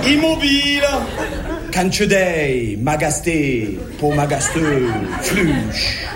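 mock Norwegian joke. Comic telling a Norwegian joke in mock Norwegian gibberish. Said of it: "Et ça, c'est une blague".

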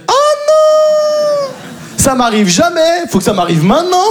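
very high yelp then slowing lament; panicked inner voice. Retold or imitated. Recognised by a policewoman while stoned, high panicked wail. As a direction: wail at the bad luck. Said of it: "je fais".

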